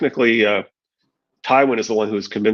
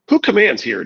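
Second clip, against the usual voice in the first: high, loud demand; demanding loudly; 9 semitones higher than usual. A narrator's reading. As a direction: call out with authority. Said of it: "Jamie demanded loudly".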